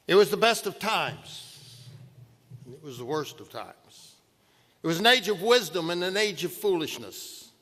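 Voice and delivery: measured literary recitation; poem recitation. Acted. Reciting Dickens opening lines.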